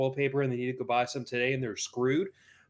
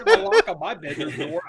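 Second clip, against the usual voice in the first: laughing; 8 dB louder than usual. Real, not acted. Co-host joking while laughing.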